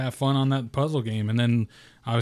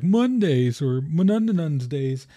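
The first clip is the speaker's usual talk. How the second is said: mocking whiny voice; 4 semitones higher, 4 dB louder, slower than usual. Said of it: "people are like".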